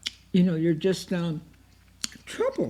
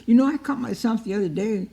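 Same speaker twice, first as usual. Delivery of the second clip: usual speaking voice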